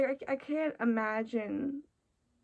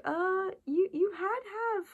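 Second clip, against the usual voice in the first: higher, stammering voice of her own brain; nagging inner voice; 8 semitones higher than usual. Retold or imitated. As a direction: play your brain calling you out. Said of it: "my brain will be like".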